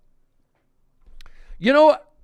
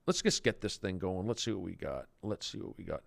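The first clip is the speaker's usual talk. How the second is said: low, soft mutter; under his breath; muttering quietly; 9 semitones lower, 13 dB quieter than usual. Real, not acted. Muttering to himself.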